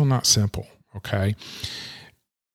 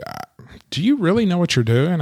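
higher-pitched sceptical question; imitated doubt, pitched up; doubting client; 8 semitones higher than usual. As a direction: mimic a client calling to challenge you. Voicing a doubting client's phone call.

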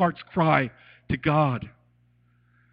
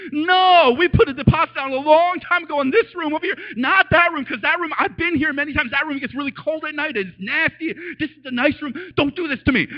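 pitched-up, louder pleading argument; frantic arguing; 9 semitones higher, 4 dB louder than usual. Retold or imitated. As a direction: argue desperately with the office staff over the room. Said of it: "broken Korean, Konglish".

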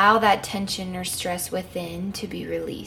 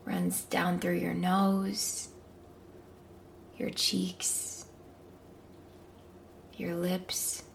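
slow gentle whisper; 8 dB quieter, slower than usual. Acted. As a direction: whisper each body part. Whispered body scan.

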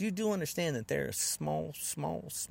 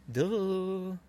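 drawn-out, higher dopey duh; mock them as dim; 5 semitones higher than usual. Mocks followers as stupid.

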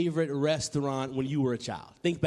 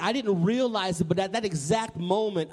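ordinary talk, nothing marked

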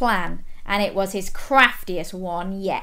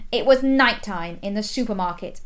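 usual speaking voice

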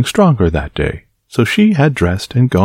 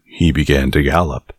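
usual speaking voice

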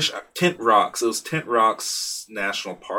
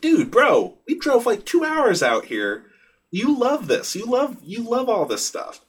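put-on much higher, strained pleading with a toddler; exasperated pleading; 12 semitones higher, 3 dB louder than usual. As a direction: throw up your hands at the kid, pitch way up.

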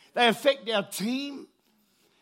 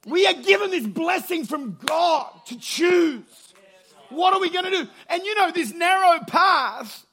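full-force preaching; 7 semitones higher, 6 dB louder than usual. Acted.

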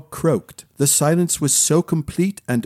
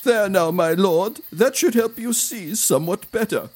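lightly voiced retainer, higher in pitch; a hint of a soothing servant, pitched up; retainer's voice; 6 semitones higher than usual. A narrator's reading. Retainer wiping mud from the prince, lightly voiced servant.

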